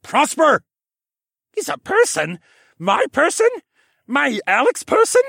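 high, loud, excitable creature voice; Jar Jar Binks and Doug from Up blend. A narrator's reading. Creature's voice popping into Alex's head, goofy and high. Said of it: "A voice that sounded like a combination of Jar Jar Binks and Doug from Up".

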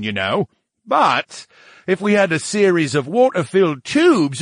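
peevish and emphatic; grumpy talking brain. Acted. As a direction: gripe in a huffy character voice.

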